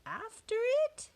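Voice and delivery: very high, questioning squeak; timid echo. A narrator's reading. Crew balks at captain's order, high timid question. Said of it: "we all whispered".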